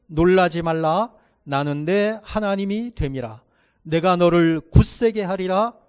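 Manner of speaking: loud unison Bible reading; congregational scripture reading. Real, not acted. Pastor leads the congregation reading aloud. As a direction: read it out together, full voice.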